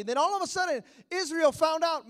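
usual speaking voice

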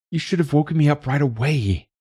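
high-pitched energized daughter; suddenly energized. A narrator's reading. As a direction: burst out in mild reproach, pitched high. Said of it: "suddenly energized".

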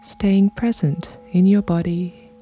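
unhurried meditation voice with long pauses; guided meditation. Real, not acted. Podcast host guiding listeners into their bodies, calm meditation delivery. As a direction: guide them gently through breath and body, leaving space between phrases.

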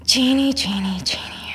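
breathy whisper, musing on the name. Real, not acted.

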